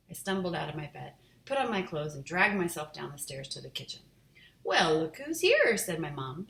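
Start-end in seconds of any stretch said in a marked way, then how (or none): 4.6-5.8 high, slow mock greeting; teasing. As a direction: tease him gently. Mom teasing sleepy son.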